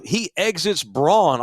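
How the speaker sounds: ordinary talk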